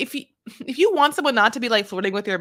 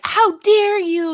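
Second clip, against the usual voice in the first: high, loud, drawn-out indignation; mock outrage; 10 semitones higher, 8 dB louder, much slower than usual. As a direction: play a scandalized objector, hammed up. Imitating outraged women listeners.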